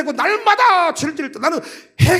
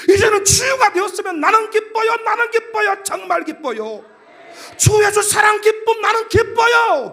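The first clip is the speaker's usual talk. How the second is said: jubilant proclamation; 6 semitones higher than usual. Acted. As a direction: proclaim joy to the whole congregation. 치유된 기쁨을 찬송처럼 외침. (기쁨에 찬 찬송조 외침)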